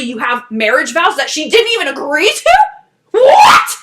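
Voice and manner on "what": high loud shocked what; incredulous what. Real, not acted. Reacting to the vows, a loud high shocked what. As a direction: squeal it in total disbelief.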